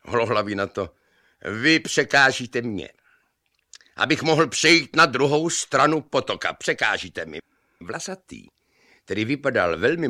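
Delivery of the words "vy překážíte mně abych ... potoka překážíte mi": raised, higher retort dropping at the end; hollering back. A narrator's reading. Bald man answers on the footbridge, hollering back. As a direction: argue back over the noise. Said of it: "museli na sebe pěkně hulákat".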